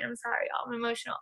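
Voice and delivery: sorry, voice almost gone from crying; tearful apology. Real, not acted. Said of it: "I can't stop crying".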